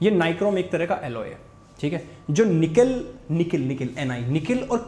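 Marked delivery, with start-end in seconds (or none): none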